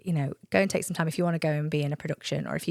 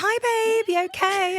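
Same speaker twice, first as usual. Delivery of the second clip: high chirpy mum voice, pitched way up; mum's sing-song greeting; 14 semitones higher, 4 dB louder than usual. Retold or imitated. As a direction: greet like a cheery mum with a dog under her arm. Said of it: "she's like".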